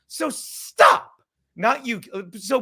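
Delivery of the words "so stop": shouting. Real, not acted. Angry demand to stop.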